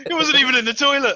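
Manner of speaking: laughing punchline. Real, not acted. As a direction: squeal it through laughter.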